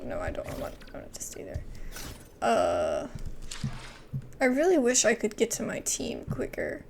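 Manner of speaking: usual speaking voice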